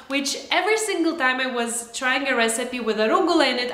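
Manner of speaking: usual speaking voice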